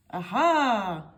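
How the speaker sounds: Mister Small has a plan, chuckling aha. A narrator's reading. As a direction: chuckle it slyly. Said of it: "chuckled Mister Small".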